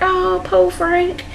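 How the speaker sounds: high, slow, drawn-out sympathy; soft, high-pitched poor-thing voice; mock pity. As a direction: coo with exaggerated pity. Mock pity for Frank in recap.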